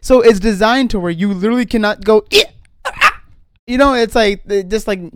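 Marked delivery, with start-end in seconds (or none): 2.3-3.2 short high startled yelps. Shown as a demonstration. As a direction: squeak out a scared little yelp. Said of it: "making noises to get scared".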